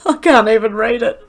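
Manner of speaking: high voice breaking up with laughter; helpless giggles between the words; laughing too hard. Real, not acted. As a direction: try to talk through real laughing. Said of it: "I'm crying from laughing".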